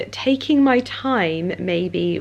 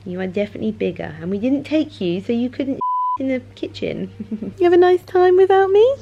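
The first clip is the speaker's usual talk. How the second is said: talking to the dog; 5 semitones higher than usual. Real, not acted.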